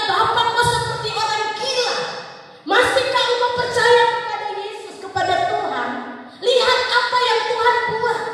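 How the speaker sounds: high, loud scolding as Job's wife; acted shouted reproach at a husband; loud taunting rebuke. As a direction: hurl it at him as an exasperated wife. Acting Job's wife rebuking him.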